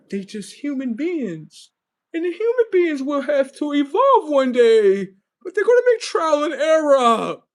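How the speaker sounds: a lightly voiced gentle mentor pleading for patience; merciful Professor Xavier.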